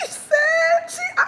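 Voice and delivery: very high, excited squeal; squealed mock outrage; worked-up retelling. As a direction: jump way up high as you relive the outburst. Recalling companion's angry outburst.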